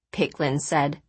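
ordinary delivery

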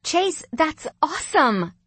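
high, slow, thrilled praise; excited sister's congratulation; delighted. A narrator's reading. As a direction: stretch it out, impressed and pleased. Sister delighted by brother's news.

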